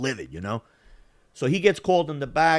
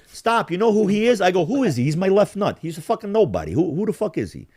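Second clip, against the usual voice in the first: raised, indignant back-and-forth; retold scornful argument; 6 semitones higher, 4 dB louder than usual. Man in bar belittling his rival, heated scorn. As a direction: dismiss him with loud contempt.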